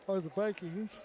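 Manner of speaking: talking through a yawn; words stretched by a yawn. Real, not acted. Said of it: "yawning there".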